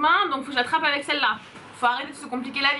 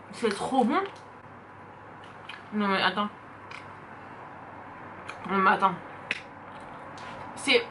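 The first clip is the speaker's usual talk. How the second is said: chewing while talking; 5 semitones lower, 6 dB quieter than usual. Real, not acted. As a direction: mouth full. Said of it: "Je vais pas parler avec la bouche pleine".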